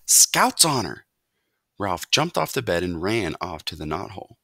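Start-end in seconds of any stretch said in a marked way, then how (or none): 0.1-1.1 slow, proud little mouse vow; eager promise. A narrator's reading. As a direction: swear it proudly.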